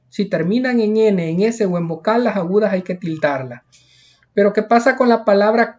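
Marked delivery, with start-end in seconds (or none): none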